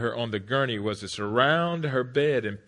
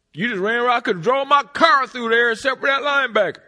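yelling coach; 12 semitones higher, 11 dB louder than usual. Retold or imitated.

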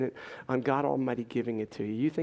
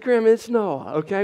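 laughing insistence; 5 semitones higher, 8 dB louder than usual. Real, not acted.

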